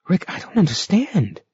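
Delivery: high, confused woman's voice; bewildered Sylvia. A narrator's reading. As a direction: plead in confusion.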